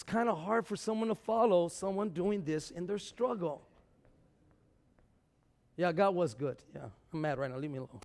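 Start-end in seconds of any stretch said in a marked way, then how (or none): 5.8-8.0 low, soft grumble of a sulking believer; sulky mutter. Acted.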